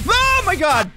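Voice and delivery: screamed very high and loud; terrified scream. Real, not acted. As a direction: shriek it in real terror. Terrified by a sudden scare.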